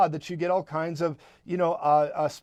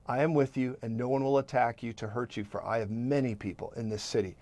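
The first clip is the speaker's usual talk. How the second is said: softer and lower, tender; soft low repeat; 6 semitones lower, 6 dB quieter than usual. Acted. Preacher gently repeating God's promise. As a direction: speak it gently to each heart. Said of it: "one more time".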